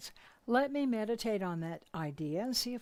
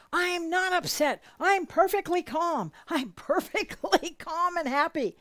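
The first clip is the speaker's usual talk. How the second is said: loud, high, huffy insistence he's calm; husband shouting that he's not upset; mock angry denial; 8 semitones higher, 8 dB louder than usual. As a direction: yell that you're perfectly calm. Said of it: "He would say".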